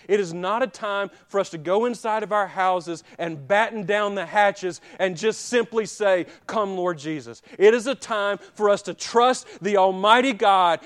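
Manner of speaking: rally the church to trust God; full-force preaching. Real, not acted.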